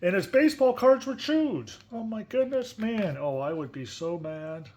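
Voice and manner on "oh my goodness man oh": plain reading voice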